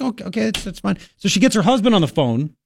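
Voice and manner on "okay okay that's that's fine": imitating calm cop. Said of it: "And he's like".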